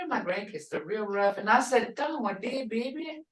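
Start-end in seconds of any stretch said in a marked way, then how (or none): 1.9-3.2 put-on soft, high "Don, what did, baby?"; grandma softly asking the hurt granddaughter; tender concern. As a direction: ask gently like a worried grandma. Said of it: "Hear that?".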